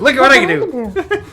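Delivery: put-on high squealing kid voice, laughing; Stewart from Mad TV. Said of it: "it's like a Stewart move".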